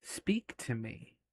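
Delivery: soft, hushed statue voice; statue speaking. Acted. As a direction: speak gently and quietly as an ancient statue. Game master voices the statue.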